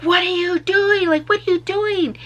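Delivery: high, loud and panicked; alarmed disbelief. Retold or imitated. Narrator to passenger flipping off cop, high alarmed yell. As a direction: yelp it at your reckless friend.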